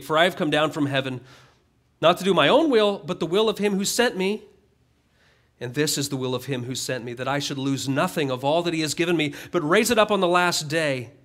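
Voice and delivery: preacher reading Jesus's words aloud; scripture reading. Acted. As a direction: proclaim the verses to the congregation.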